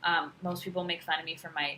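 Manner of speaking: usual speaking voice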